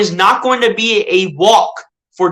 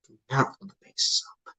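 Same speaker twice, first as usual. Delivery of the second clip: quiet pleading; 4 semitones lower, 14 dB quieter than usual. Real, not acted. Begging pitcher not to walk.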